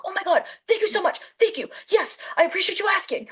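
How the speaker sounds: gushing Tom. Retold or imitated. Imitating Tom thanking a fan, high and gushing. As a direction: overdo his grateful thank-yous. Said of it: "He's like".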